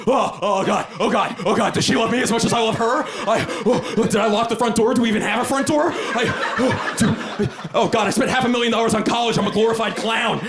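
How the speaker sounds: high-pitched, racing anxious spiral; panicked worrying. Acted. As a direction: let the worries tumble out in a high panic. Anxious spiral before going to sleep.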